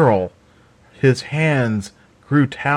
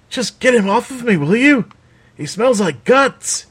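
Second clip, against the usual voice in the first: much higher, pleading in disgust; disgusted Raven; 12 semitones higher than usual. A narrator's reading. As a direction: squeal at him to pull the wolf off. Wolf licking her face.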